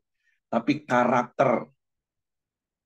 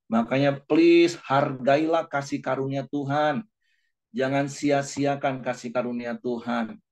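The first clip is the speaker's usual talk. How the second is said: earnest plea; 3 semitones higher than usual. Real, not acted. Pleading with congregation.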